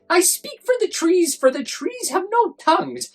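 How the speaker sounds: very high, loud, sharpish bossy yell; the Lorax shouting at the Once-ler to defend the trees, high and loud. A narrator's reading. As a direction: shout it for the trees at the top of your lungs. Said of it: "at the top of my lungs".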